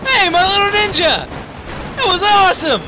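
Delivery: high, loud delighted cheering; thrilled shouting. Real, not acted. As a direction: whoop with delight at the crash. Delighted by a spectacular crash.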